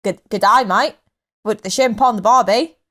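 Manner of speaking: stock Aussie greeting accent; Australian accent. Shown as a demonstration. Podcast guest trying an Aussie catchphrase, Australian accent. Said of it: "have we done an Australian accent".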